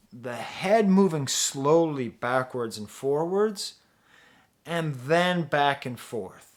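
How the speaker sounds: slowed right down, measured and deliberate; slow, swaying emphasis. Shown as a demonstration. Reviewer showing viewers how the beat moves the head, slow and deliberate. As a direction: draw the words out to a slow head-nod beat.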